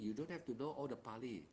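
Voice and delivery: usual speaking voice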